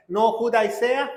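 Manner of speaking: ordinary talk, nothing marked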